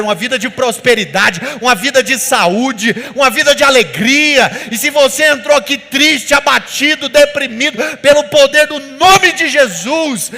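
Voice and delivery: loud, rising sermon declaration, words driven hard; full-force preaching. Acted. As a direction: preach it to the whole congregation, building to a roar.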